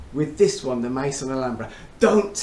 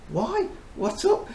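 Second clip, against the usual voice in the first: sharply higher, puzzled question; surprised why; 14 semitones higher, slower than usual. Retold or imitated. Retelling asking the colleague why he wants it back, surprised. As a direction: pop up in surprise at the odd request.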